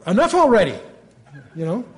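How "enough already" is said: higher and louder, fed up; exasperated God. Retold or imitated. God cuts off the pestering Moses, exasperated. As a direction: snap it like a fed-up parent. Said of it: "but here God says".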